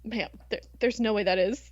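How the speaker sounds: usual speaking voice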